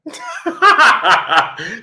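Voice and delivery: high burst of real laughter; laughing. Real, not acted. Host laughing at Manny's answer, real laugh. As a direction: crack up at the memory.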